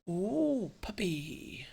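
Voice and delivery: high delighted "ooh, puppy"; excited coo. Retold or imitated. Spotting a stray puppy, cooing with delight. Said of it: "I'm like".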